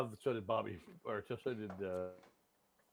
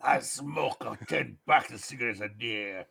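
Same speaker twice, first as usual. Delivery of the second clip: hammed-up old actor impression, slow and drawn out; John Hurt; 4 semitones lower, 4 dB louder, slower than usual. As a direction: overdo the actor's voice, louder. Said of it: "you gotta do it like John Hurt".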